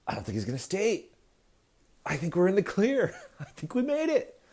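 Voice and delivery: high, giddy mock relief with a laugh; relieved bros. Republicans thinking they are in the clear, high and giddy.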